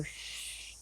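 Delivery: soft, unvoiced shush; a long, quieting shh; hushing shh. Shown as a demonstration. Teacher shows meditators how to quiet down, soft shush.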